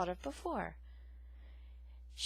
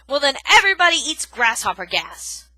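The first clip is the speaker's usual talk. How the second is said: gruff retort; 6 semitones higher, 16 dB louder than usual. A narrator's reading. Porcupine sarcastic retort.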